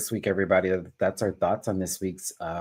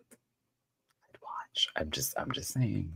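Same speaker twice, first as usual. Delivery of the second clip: whispering; 9 dB quieter, much slower than usual. Real, not acted. Sly aside about a host.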